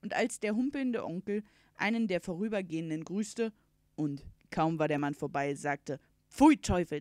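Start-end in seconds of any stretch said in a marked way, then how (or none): 6.3-7.0 loud, punchy exclamation; exclaimed curse. A narrator's reading. Uncle cursing the passing bailiff, loud exclamation. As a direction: burst it out in disgust.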